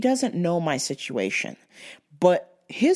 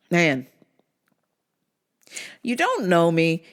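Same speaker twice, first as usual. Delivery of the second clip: usual speaking voice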